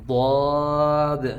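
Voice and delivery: ضاد slow and full-mouthed, heavy; heavy daad. Shown as a demonstration. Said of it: "اس کی sound جو ہے وہ موٹی ہوتی ہے".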